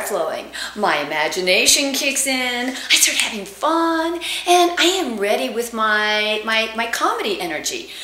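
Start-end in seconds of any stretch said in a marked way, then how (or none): none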